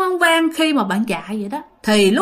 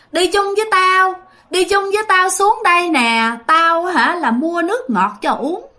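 put-on higher, eerie old woman with a northern lilt; old northern woman's voice; 6 semitones higher than usual. Storyteller voicing the ghost old woman calling, eerie and high. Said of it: "giọng là của một bà già mà giọng Bắc".

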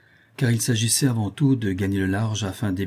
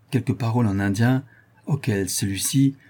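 usual speaking voice

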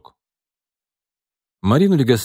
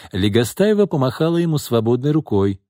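usual speaking voice

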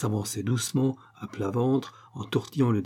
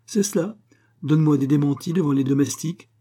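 high, indignant comeback, getting louder; sharp retort; 5 semitones higher, 5 dB louder than usual. A narrator's reading. Wife contradicted before the servant, sharp retort. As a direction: fire back in indignation. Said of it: "répondre avec vivacité".

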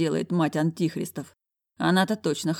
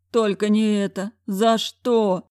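despairing director; 5 semitones higher, 5 dB louder, slower than usual. A narrator's reading. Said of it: "Воздел руки к натяжному потолку директор".

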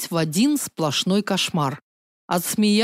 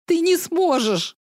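high, sobbing cry with stress on не; 10 semitones higher than usual. Acted. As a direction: cry it out through tears. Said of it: "всхлипнула".

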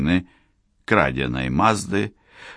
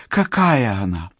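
bright, delighted high voice; gleeful high voice; happy excited; 10 semitones higher, 3 dB louder than usual. A narrator's reading. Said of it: "Черил радостно засмеялась".

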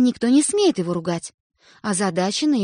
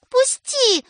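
high, loud cry; desperate shout; 4 dB louder than usual. A narrator's reading. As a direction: cry out to be let go. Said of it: "отчаянно кричит сестра".

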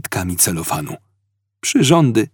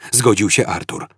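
ordinary delivery

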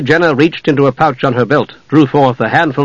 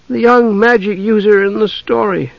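put-on high, airy girl's reply; offhand; 9 semitones higher than usual. A narrator's reading. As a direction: toss it off casually. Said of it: "Usha said offhandedly".

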